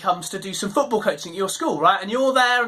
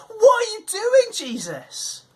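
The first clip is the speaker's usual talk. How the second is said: indignant crowd; 10 semitones higher, slower than usual. Retold or imitated. Crowd objecting to Jesus dining with Zacchaeus, high indignant cry. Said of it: "they respond like we'd respond".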